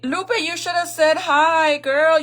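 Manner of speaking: high, loud, drawn-out greeting; a big happy hello, slowed down; excited shout-out. Real, not acted. Calling out to viewer Lupe from the state fair, excited.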